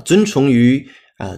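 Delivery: usual speaking voice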